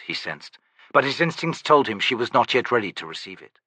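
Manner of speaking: ordinary delivery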